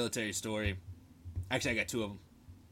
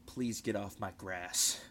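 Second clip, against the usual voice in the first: slow, low, tightly held-back imitation; suppressed rage; 4 semitones lower, 4 dB quieter, slower than usual. Sergeant holding back at an airman, slow mock restraint. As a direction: force politeness over buried fury, slowly. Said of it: "shove all the P T S D down".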